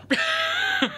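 very high squeal of frustration; high-pitched wail of irritation; exasperated squeal. Real, not acted. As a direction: let out a high, frustrated shriek. Outburst over lawnmower noise.

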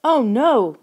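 high, loud panicked shriek. A narrator's reading. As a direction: cry it out in sudden horror. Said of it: "Lauren shrieked".

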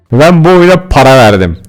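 yelled at full volume; indignant yell. Real, not acted. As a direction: shout the complaint in disbelief. Complaining about a bad purchase. (bağırarak isyan)

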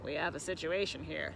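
low father frog voice. A narrator's reading. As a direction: speak low as the concerned father. Father frog speaks to family.

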